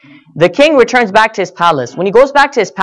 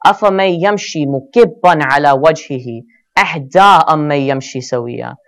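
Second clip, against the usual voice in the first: fast, melodic Quranic recitation; 5 semitones lower, much faster than usual. Acted. Preacher reciting the verse to his congregation, melodic recitation. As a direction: chant the verse in tajwid.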